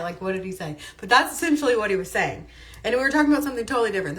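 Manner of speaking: ordinary talk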